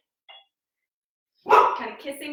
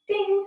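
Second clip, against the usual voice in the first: mock timer ding; 8 semitones higher than usual. Imitating a timer bell.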